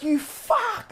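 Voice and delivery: very high strangled cry; high-pitched pained yelp; imitated shriek. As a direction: squeal it out in pain. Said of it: "like that".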